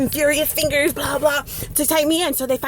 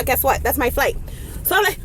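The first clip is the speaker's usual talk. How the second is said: normal talking voice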